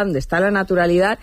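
ordinary talk, nothing marked

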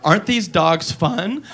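put-on slow, soft girl voice; drawn-out sweet girl line. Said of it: "now she goes".

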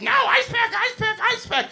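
very high shrieked demand; screaming little girl. Retold or imitated. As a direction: imitate a little girl's insistent shriek. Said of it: "Little girl screaming in my face".

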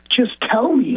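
high-pitched, retold desperate demand; insistent plea. Mark to the crying caller on the phone, insistent. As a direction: plead insistently for the news. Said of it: "and I kept saying".

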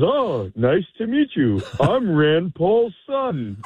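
high, drawn-out mock drunk greeting; drunk young man. Drunk introduces himself to a stranger at the bar, mock drunk. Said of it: "somebody is completely bombed".

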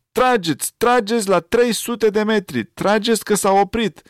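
high urgent shouted orders; a child yelling into the phone; girl shouting. A narrator's reading. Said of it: "Măriuca a strigat". (fetiță care strigă în receptor)

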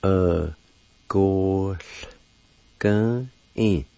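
"Uh, gosh, gun in" said as uh-goh-gun-een, syllable by syllable; Cherokee word. Shown as a demonstration. Teacher showing the word for perceive, carefully. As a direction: say "Uh, gosh, gun in" in separate beats. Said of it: "like this".

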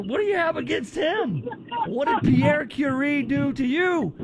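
high, indignant mock protest; mock outrage. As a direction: pretend to be offended on Curie's behalf. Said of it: "I was like".

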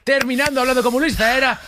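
very high, excited proclamation; triumphant announcing. Real, not acted. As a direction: celebrate like a game-show host. Host announces challenge completed. (anuncio triunfal muy agudo)

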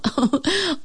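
bright yippy giggle; a quick happy laugh; high little dog laugh. A narrator's reading. Sluggo laughs excitedly.

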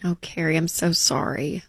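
soft sympathy. Real, not acted. Host softly consoling a grieving widow caller. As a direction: gently comfort her.

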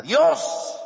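slow, high, full-force shout; impassioned preaching cry. Real, not acted. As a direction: cry it out slowly at the top of your voice. Preacher's climactic cry to congregation. (grito apasionado y prolongado)

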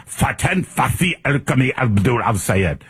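mock Arabic accent on every name; showy Arabic-accented name; exaggerated Arabic pronunciation. Shown as a demonstration. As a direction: roll out the long name with hammy Arabic sounds. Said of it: "I wanna pronounce it properly".

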